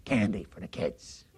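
put-on low, slow old man's reply; a hint of a slow, low old voice; lightly voiced old man. Old man tells the pastor what's in his pocket, lightly voiced.

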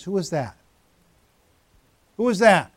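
usual speaking voice

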